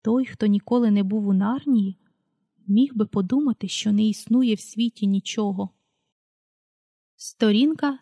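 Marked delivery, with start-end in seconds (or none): none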